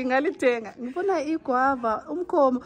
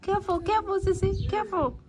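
warning call; 7 semitones higher than usual. Real, not acted. Warning child near dogs.

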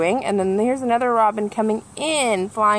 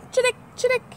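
very high chirpy jingle; sparrow chirp; much faster than usual. Acted. Reading to kids, a very high sparrow chirp. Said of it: "Sparrow makes a simple jingle".

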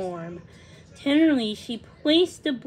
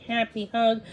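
usual speaking voice

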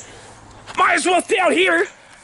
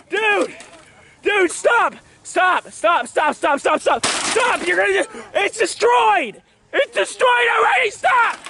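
frantic shouting; 2 semitones higher, slower than usual. Real, not acted. Vlogger begs Tyler to stop, frantic shouting. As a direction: yell desperately for it to end. Yelling stop during destruction.

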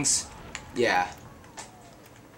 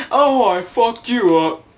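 ordinary delivery

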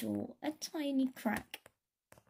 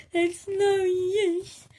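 loud, high, slow wail; bawling; 10 semitones higher, 8 dB louder, much slower than usual. Acted. Alien Botty bawls to the band, loud wailing. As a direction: howl it out in despair. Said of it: "bawled Botty".

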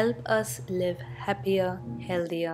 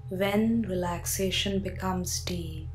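guided sleep meditation. Acted. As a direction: talk the listener gently down into sleep.

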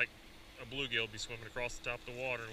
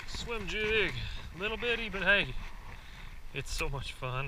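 high, loud and gleeful; much higher, bubbling with fun; excited; 8 semitones higher, 6 dB louder than usual. Real, not acted. Thrilled while reeling in fish.

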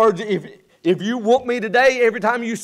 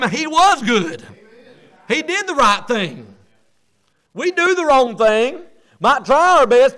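loud and high, driving the point home; full-force preaching; 5 semitones higher, 4 dB louder than usual. Acted. Pastor rousing his church, preaching at full force. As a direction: preach it hard to the congregation.

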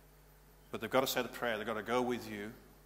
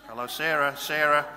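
loud, higher calling of Sarah; 3 semitones higher, 9 dB louder than usual. Shown as a demonstration. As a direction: call her back loudly. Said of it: "Not when I'm holding their face".

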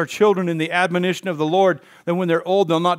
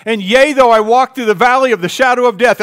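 loud, ringing scripture line; fervent psalm; 6 semitones higher, 9 dB louder than usual. Acted. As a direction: proclaim it like a preacher. Reciting psalm at full force.

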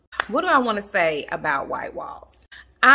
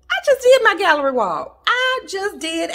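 loud, high-pitched protest; indignant protest; 12 semitones higher, 9 dB louder than usual. Real, not acted. Vlogger just finished the wall now called outdated, loud indignant protest. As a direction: protest it loudly, pitch shooting up. Protesting that gallery walls are out.

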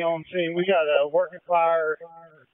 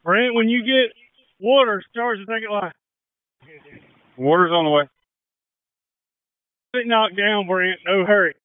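loud, higher calls to a crew member; raised radio call; 4 semitones higher, 6 dB louder than usual. Real, not acted. Commander to Brent on the line, calling out loudly. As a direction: call it out over the noise.

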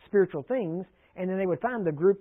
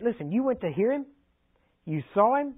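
higher, scornful challenge; incredulous Pharisees; 6 semitones higher than usual. Acted. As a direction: Pharisees scolding their officers, scornful and higher. Pharisees scold the officers.

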